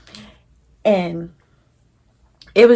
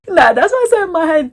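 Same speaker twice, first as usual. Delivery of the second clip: high, loud laughing aside; 11 semitones higher, 10 dB louder than usual. Real, not acted. Telling viewers she only thought it, laughing high and loud. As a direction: laugh through the words.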